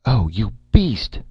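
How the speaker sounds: high, loud furious cry; angry cry. A narrator's reading. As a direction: hurl the insult at him. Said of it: "Erica cried".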